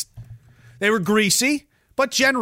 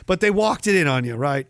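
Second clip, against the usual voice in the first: ordinary talk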